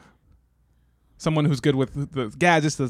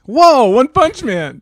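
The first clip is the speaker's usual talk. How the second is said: high, slow, loud whoa; awed whoa; 10 semitones higher, 10 dB louder, much slower than usual. Acted. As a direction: stretch out an amazed whoa. Awed greeting.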